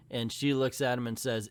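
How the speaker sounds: normal talking voice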